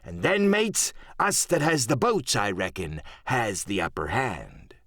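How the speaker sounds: put-on rally your crew as a cunning old sea dog; scheming pirate cook.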